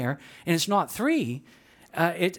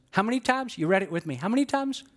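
normal talking voice

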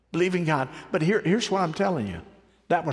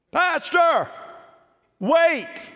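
calling out; 12 semitones higher, much slower than usual. Retold or imitated. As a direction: shout after the pastor in the rain.

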